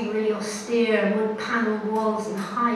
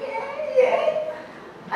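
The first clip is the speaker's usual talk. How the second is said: much higher, airy, half-laughing; high squeaky yeah. Retold or imitated. Tourist admitting it to the officer, high squeaky yes. Her teary reply, voice breaking.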